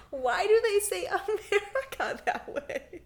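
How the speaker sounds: high, slow question through laughter; cracking up mid-question; laughing. Real, not acted.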